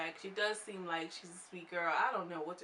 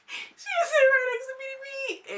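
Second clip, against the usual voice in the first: laughing hard; 8 dB louder, slower than usual. Real, not acted. Cracking up retelling awkward moment.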